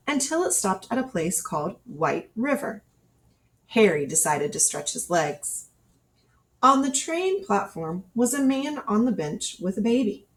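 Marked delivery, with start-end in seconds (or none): none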